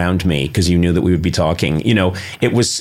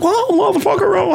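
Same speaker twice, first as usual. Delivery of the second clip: much higher, strange put-on voice for a silly outburst; Amy Sedaris in character. Host imitates Amy Sedaris crashing her own intervention in a squeaky put-on voice. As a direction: squeak it out like a cartoon character caught off guard. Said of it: "And she'd be like".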